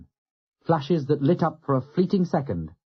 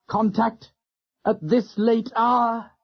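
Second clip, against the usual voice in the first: groaning dismay; 9 semitones higher, slower than usual. A narrator's reading. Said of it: "Turner groaned".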